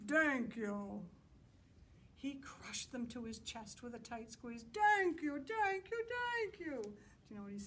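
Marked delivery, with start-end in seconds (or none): 0.1-1.0 loud monster's first words, mispronounced; big, clumsy monster thanks; deep booming monster. Acted. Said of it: "in a deep, booming voice". 4.7-6.8 high, loud monster thanks with hard T; overjoyed, clumsy monster cries; grateful monster. Acted. Monster hugging its makers gratefully.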